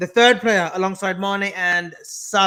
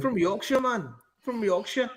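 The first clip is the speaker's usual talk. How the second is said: higher, softer attempt at a Yorkshire accent; 4 semitones higher, 7 dB quieter than usual. Shown as a demonstration. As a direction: have a go at broad Yorkshire. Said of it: "what's a Yorkshire accent?".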